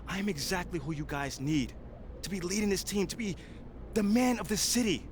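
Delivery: firm public address, building to passionate peaks; press conference speech. Acted. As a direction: make your case to the reporters, rising as you push. Player speaks at press conference.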